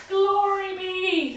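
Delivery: high, slow, loud "Glory be"; old lady cry. Acted. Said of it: "the little old lady cried".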